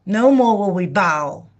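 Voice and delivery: ordinary talk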